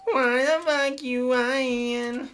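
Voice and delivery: slow teasing. Pretend.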